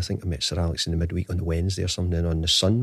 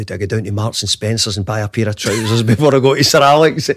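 louder, laughing towards the end; amused retelling; 2 semitones higher, 6 dB louder than usual. Retold or imitated. Telling his wife about buying trousers, amused mock fretting. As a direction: let a laugh break into the retold fretting.

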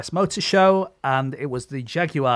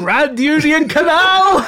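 mock proud shout; 12 dB louder, slower than usual. Joking shout of a destination.

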